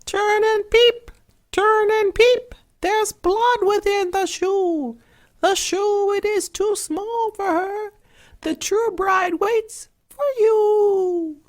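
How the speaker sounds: very high, piping bird voice; pigeons crying. A narrator's reading. Pigeons on the hazel tree warn the prince, high piping call. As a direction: chirp it out like a little pigeon.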